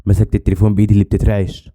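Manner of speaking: plain reading voice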